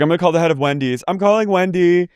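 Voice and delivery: high, fast chirpy caller; bright business call voice; mock businesswoman. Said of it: "She's like".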